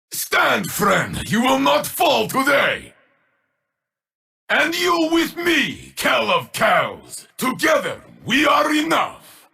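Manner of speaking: high, ringing cry to an ally; rallying shout. Acted. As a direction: lift your friend up with a war cry.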